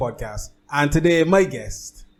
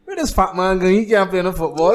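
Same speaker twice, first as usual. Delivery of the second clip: higher, louder imitated heckle; jeering crowd; 6 semitones higher, 6 dB louder than usual. Retelling the crowd as he entered the football field, mock heckling. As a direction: call it out like a mocking spectator. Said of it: "'cause everybody was like".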